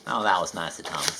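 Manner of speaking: usual speaking voice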